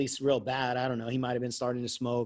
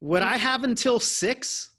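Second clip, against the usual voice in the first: put-on smart-aleck teen; 9 semitones higher, 4 dB louder than usual. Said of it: "I go".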